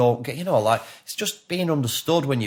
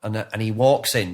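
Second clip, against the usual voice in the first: ordinary talk, nothing marked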